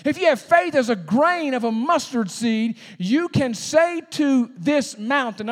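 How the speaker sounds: loud, high, driving pulpit voice; full-force preaching. Real, not acted. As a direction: preach it as Jesus urging the disciples, at full force.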